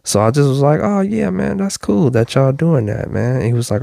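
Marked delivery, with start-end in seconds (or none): 0.8-3.4 mock friendly. Retold cheerful reply at gym.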